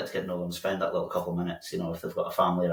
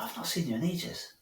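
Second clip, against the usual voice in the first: pitched way up, soft and surprised; a bright, airy hello of surprise; surprised greeting; 9 semitones higher, 7 dB quieter than usual. Retold or imitated. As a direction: greet him like a long-lost mate. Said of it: "I was like".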